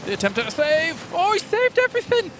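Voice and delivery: high, loud hype call; excited play-by-play. Real, not acted. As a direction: call the save at full hype. Dramatic save called live.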